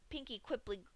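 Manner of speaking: ordinary delivery, nothing marked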